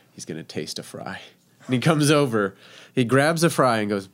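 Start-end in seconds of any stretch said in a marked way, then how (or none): 0.1-1.2 much softer, lower aside; hushed inner thought. Retold or imitated. Nervous cook waiting by the window, hushed inner thought. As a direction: drop to a hushed, tense aside.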